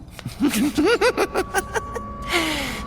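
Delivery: long, high-pitched giggle; mad giggle. Acted. Scribe amused at his own gossip, high mad giggle. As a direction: giggle like an unhinged old record-keeper.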